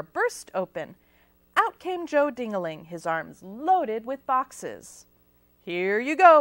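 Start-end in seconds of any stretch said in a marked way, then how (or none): 5.6-6.4 much louder, curt; barked. A narrator's reading. Joe Dingaling handing pizzas to applicants, loud bark. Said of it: "barked Joe".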